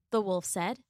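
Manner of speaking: usual speaking voice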